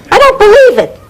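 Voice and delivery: high-pitched wail of dismay; exasperated high outburst; distraught disbelief. Acted. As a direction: cry it out, pitch shooting up. Distraught at having nothing left.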